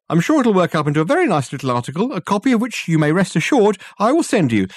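high, loud, gushing farewell; Dirk's effusive voice. Acted. Dirk gushing thanks at the door to Mr Coleridge. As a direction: lay the flattery on thick and fast.

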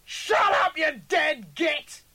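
very high, full-volume shriek; furious screech. A narrator's reading. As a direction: screech the insult, drawn out. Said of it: "The double screeched".

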